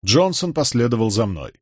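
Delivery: ordinary delivery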